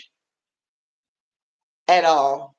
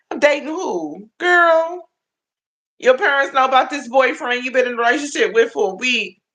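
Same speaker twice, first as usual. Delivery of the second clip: mock auntie scold; 7 semitones higher than usual. Real, not acted. Auntie scolding young viewer.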